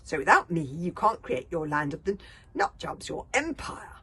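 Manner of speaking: high loud pleading; shouting. Acted. Boy shouts reasons to the villain. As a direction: shout the argument down. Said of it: "Hiccup shouted".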